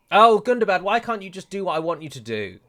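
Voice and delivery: high, loud groan at the army; exasperated outburst. Real, not acted. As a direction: throw up your hands at Gundabad. Exasperated at his own army.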